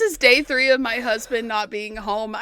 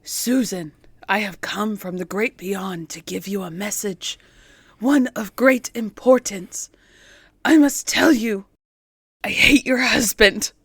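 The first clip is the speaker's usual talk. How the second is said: spirit through a medium; 6 semitones lower than usual. Acted. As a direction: speak as the spirit from beyond.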